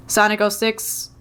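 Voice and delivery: ordinary delivery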